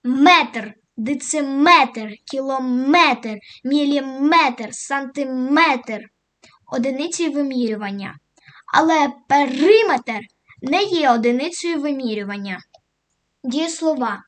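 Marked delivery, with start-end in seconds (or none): 0.0-6.0 «метр» in «сантиметр» said slow and loud; stress on метр. Shown as a demonstration. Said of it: "stress on метр". 8.7-10.2 «периметр» louder with stress on «и». Shown as a demonstration. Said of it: "contrast stress".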